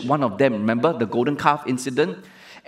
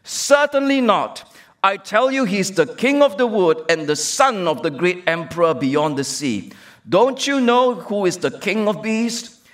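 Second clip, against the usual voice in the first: high, louder, slow character voice; stern Mister Beaver; 6 semitones higher, 3 dB louder than usual. Acted. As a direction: lecture the child like an indignant beaver. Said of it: "said Mister Beaver sternly".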